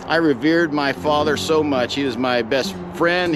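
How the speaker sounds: ordinary talk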